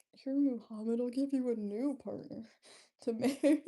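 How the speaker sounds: loud, hammed-up offer breaking into a laugh; playful matchmaking offer. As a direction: call it out teasingly, laughing near the end. Playing someone wooing Muhammad for laughs.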